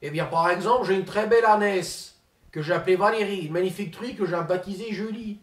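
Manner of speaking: pompous politician impersonation; Ségolène Royal impression. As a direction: play the self-important minister, lofty and indignant.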